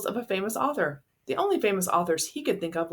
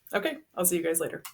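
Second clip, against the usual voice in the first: plain reading voice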